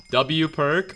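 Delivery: sudden high, loud cheer; excited cheer. Real, not acted. Streamer cheering a chat message, high and loud. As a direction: burst out thrilled. Chat praises his perk.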